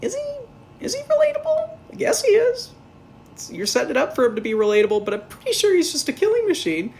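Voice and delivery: mock-incredulous squeal; incredulous high voice. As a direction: question it in high sarcastic disbelief. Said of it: "And I'm like".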